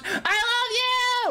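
long, high-pitched squeal of affection; gushing squeal. Real, not acted. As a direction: squeal it, drawing every word out. Gushing at a viewer.

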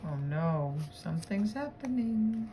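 usual speaking voice

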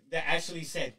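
ordinary delivery

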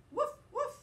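high, loud woof woof; excited dog bark. Acted. Teacher barking as Biscuit for the class, loud and eager. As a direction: bark eagerly like a puppy at play.

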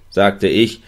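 ordinary delivery, nothing marked

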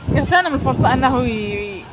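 usual speaking voice

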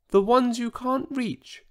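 high, small, voiced mock whisper; tiny whisper. A narrator's reading. Huge dinosaur reassures the others, in a tiny mock whisper. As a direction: squeeze it into a tiny voice. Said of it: "he whispered".

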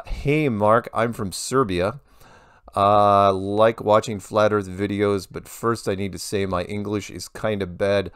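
lightly put-on Serbian accent, reading a viewer's email; a hint of a Serbian viewer's accent, slow in spots; mock Serbian accent. Reading a Serbian fan's email aloud, mild mock Serbian accent. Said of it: "I'm gonna do this for the rest of the sentences".

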